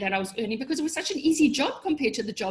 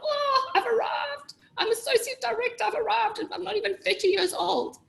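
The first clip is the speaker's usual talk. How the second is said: smug self-congratulation; 11 semitones higher than usual. Retold or imitated. Remembering landing the director job, high mock-proud gushing. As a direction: puff yourself up like you've made it. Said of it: "I thought".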